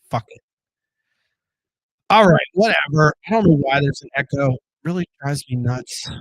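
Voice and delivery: ordinary talk, nothing marked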